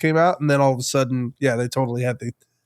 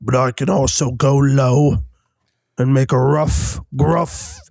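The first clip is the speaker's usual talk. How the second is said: gruff fella; 3 semitones lower than usual. Shown as a demonstration. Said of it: "a rough, gruff sounding fella like this".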